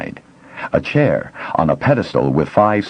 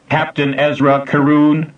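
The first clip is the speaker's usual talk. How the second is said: flat machine voice, higher, no inflection; computer voice; 9 semitones higher than usual. A narrator's reading. Facility computer addressing the intruders, mechanical. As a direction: speak like an old computer.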